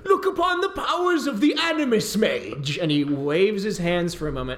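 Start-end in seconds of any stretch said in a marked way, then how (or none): 0.0-2.8 a much higher, grandly boastful lord; pompous mage. Acted. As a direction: boom out like a vain wizard. Burzin again.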